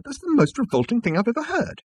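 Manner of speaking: high, revolted outburst; appalled high-voiced exclamation; exclaiming. A narrator's reading. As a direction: protest with disgust, pitched high. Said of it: "exclaimed Arthur".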